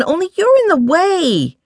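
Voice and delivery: put-on very high, exasperated girl; exasperated girlish whine. A narrator's reading. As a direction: protest in high-pitched exasperation. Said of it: "Sarai said in exasperation".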